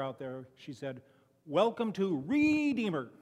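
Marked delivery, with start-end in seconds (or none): 1.5-3.1 Redeemer said slow, with its first syllable punched hard; stress on first syllable. Retold or imitated. A four-year-old greeting people at the door, Redeemer stressed on its first syllable. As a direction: lean on the start of Redeemer, like a small child greeting guests. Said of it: "with that emphasis on the first syllable".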